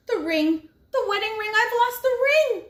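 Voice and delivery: high, loud rising panic; panicked. Acted. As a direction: shriek it as the horror sinks in. Man panics over lost ring.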